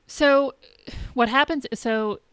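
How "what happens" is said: ordinary delivery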